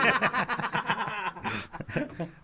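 helpless laughing fit; real laughter. Real, not acted. Laughing at own joke about Americans.

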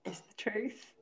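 whispered, teasing. Real, not acted. Scene: insisting to the host her story is true, whispered. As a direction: breathe it out like a secret.

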